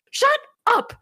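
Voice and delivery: high squeaky mock outburst; mock Luffy shout. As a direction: yell it like a defiant kid brother. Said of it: "this moment where Luffy's like".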